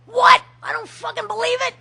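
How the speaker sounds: high, loud stunned outburst; shocked disbelief. Retold or imitated. Members in the hallway hearing the vote result, shocked loud outburst. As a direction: blurt it out in disbelief. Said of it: "people in the hallways going".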